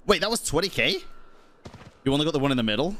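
usual speaking voice

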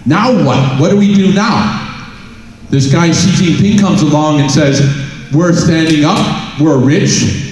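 usual speaking voice

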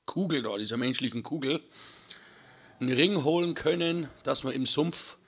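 ordinary talk, nothing marked

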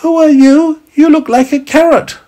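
put-on high, loud tiny boy voice; tiny boy meets a talking carrot, curious and high. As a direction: squeak it out like a small child. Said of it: "a very tiny voice said".

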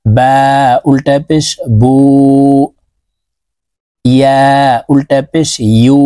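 each oo drawn long and rounded; slow drill recitation. Shown as a demonstration. Teacher drilling ulta pesh letters with viewers. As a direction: chant with rounded lips. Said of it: "এভাবে করতে হবে".

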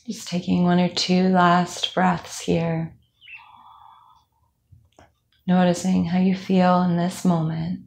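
calm, even meditation voice with long pauses; guided meditation. Acted. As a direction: guide them unhurriedly through a body scan. Leads a body scan meditation.

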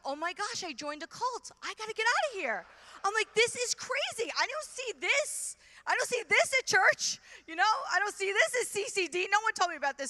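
high-pitched, alarmed outburst; comic panic. Retold or imitated. Retells panic at seeing people fall.

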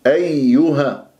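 أيها with a clearly doubled, drawn-out yā; both yā sounds. Shown as a demonstration. Teacher models أيها for students, both yā sounds slowly sounded. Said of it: "لازم أجيب صوت الياءين".

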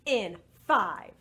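loud, spaced falling numbers; rocket countdown. Pretend. Leader starting the second blast-off, emphatic countdown. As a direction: count down firmly with gaps between.

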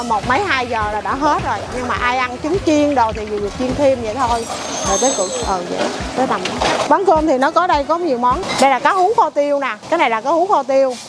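usual speaking voice

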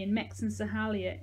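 plain reading voice

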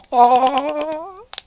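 long, loud, high rising whoop; very loud, climbing burst of glee; exultant cry. Real, not acted. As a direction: let out a high laughing yell. Exulting after the prize reveal.

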